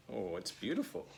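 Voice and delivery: ordinary delivery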